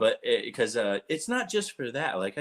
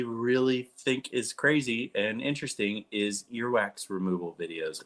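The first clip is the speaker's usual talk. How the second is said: usual speaking voice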